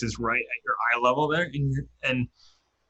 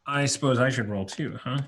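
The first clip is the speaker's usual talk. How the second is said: ordinary talk, nothing marked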